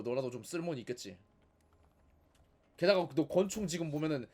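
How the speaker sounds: ordinary talk, nothing marked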